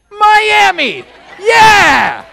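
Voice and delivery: high, loud triumphant shout; excited shout. Real, not acted. Preacher to his congregation, a loud excited shout. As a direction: cheer it out like a hometown fan. Crowd-pleasing shout of hometown.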